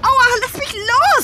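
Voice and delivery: high, loud protest; calling out in distress; struggling cry. Acted. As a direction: fight him off, crying for help. Julia struggles with Harry.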